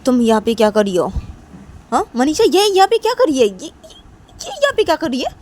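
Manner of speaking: loud, high, furious demand; angry shout. Acted. Nisha confronting Manisha at the party, angry high shout. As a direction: yell at her friend, outraged. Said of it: "तो तभी बोलती है कि".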